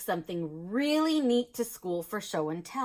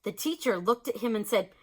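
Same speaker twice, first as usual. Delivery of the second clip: plain reading voice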